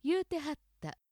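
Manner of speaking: 言うてはった in Osaka Kansai accent; Osaka-ben accent on 言うてはった. Shown as a demonstration. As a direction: demo 言うてはった with an Osaka pitch pattern. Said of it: "大阪アクセント、".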